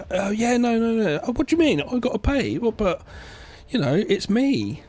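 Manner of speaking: high, dodgy mock rapper dodging the bill; lightly hammed celebrity wriggling out of paying. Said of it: "[name] running on credit".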